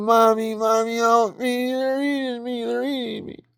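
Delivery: put-on high, panicked child's scream; mock terror, shrieking for mommy as he's eaten; a child being eaten screams for his mother, high and panicked. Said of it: "He's screaming".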